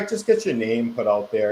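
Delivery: ordinary talk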